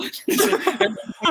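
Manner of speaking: bursting into high laughter. Real, not acted. Laughs at his own impression.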